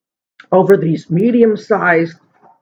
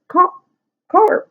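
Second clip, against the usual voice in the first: high excited urging. Retold or imitated. Daughter urging her to call.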